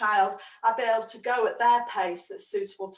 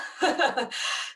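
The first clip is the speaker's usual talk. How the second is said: breathy laugh. Real, not acted. Laughs after long chant.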